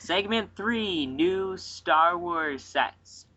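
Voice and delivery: high, drawn-out segment announcement; segment announcer. Pretend. Host announces a new segment, slow announcer voice. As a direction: announce it grandly, stretching each word.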